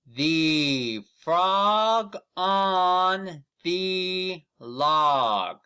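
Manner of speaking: very slow, high and loud, each word stretched; slow model reading. Shown as a demonstration. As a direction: read it out slowly for children to copy. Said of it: "repeat after me".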